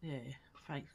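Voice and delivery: ordinary talk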